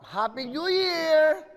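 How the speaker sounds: loud, high, drawn-out holiday cheer; game show host's big shouted greeting. Real, not acted. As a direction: belt it out, stretching the last word. Host shouts greeting to caller.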